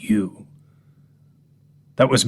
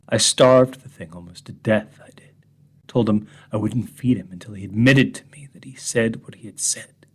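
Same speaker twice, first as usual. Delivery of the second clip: ordinary talk, nothing marked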